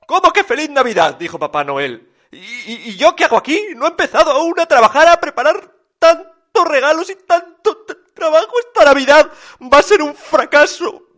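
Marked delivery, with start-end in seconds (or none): 0.0-1.1 loud, high gasping disbelief; shocked Papá Noel. A narrator's reading. Papá Noel hearing it is Christmas already, loud shock. 2.3-11.1 high, loud, stammering lament; tearful wailing. A narrator's reading. Papá Noel unprepared for Christmas, loud tearful wail. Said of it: "lloraba Papá Noel por las esquinas".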